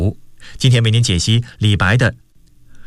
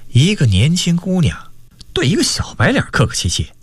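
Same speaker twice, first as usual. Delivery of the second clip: animated storyteller with acted dialogue; story narration; 4 semitones higher than usual. A narrator's reading. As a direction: narrate the tale with wide swings in pitch. Narrates a novel chapter. (绘声绘色地讲小说)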